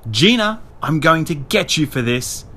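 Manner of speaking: heated, high shout; angry yell. A narrator's reading. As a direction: holler a threat at a sister. Angry girl yelling threat downstairs.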